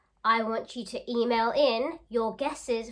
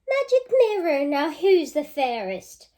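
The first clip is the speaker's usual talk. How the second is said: a hint of a demanding queen, higher and louder; mean queen; 7 semitones higher, 10 dB louder than usual. Queen demanding an answer from her magic mirror. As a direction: call out to the mirror like a vain queen.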